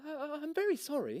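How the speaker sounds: calm the llama down sweetly; placating girl voice. Acted. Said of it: "in her most llama-mollifying tone of voice".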